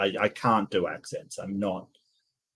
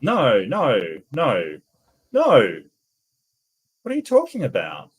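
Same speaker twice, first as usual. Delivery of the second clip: disbelieving no, no, no; emphatic denial; 2 semitones higher, 4 dB louder than usual. Real, not acted. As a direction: flatly refuse the claim. Rejecting the claim.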